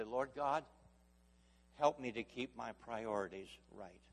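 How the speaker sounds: slow pleading prayer; earnest prayer. Acted. Preacher praying aloud before the church, earnest. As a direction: plead with God humbly.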